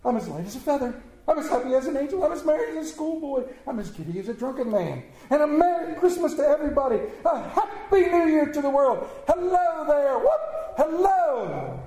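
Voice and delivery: high, exuberant calls with a whoop; giddy elation. A narrator's reading. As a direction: bubble over, then holler greetings to the world. Giddy Scrooge rejoices, whooping.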